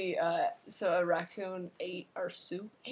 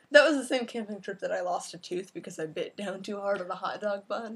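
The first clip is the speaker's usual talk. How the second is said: ordinary talk, nothing marked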